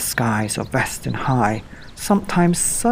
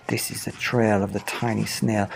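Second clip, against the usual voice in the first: ordinary delivery, nothing marked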